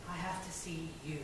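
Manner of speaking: low, soft man's voice; hushed lover. Acted. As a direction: ask quietly and intently. Acted lover asking to look.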